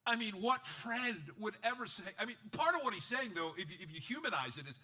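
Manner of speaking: normal talking voice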